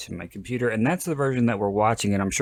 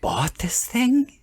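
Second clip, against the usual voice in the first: high, breathless disbelieving whisper; shocked whisper; 6 semitones higher, slower than usual. Real, not acted. Shocked someone bought the film.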